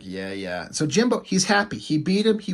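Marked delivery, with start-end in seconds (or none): 0.0-0.7 low, quiet brush-off; downplayed, much softer and lower; acted muted reply. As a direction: drop it low and soft, as the beaten dragon. Voices the downed dragon's weary reply.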